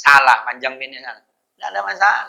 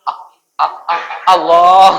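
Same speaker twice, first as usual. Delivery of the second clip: louder stammer stuck on ah; stammering imam imitation; mock stutter; 3 semitones higher, 8 dB louder than usual. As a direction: stutter toward the word Allah.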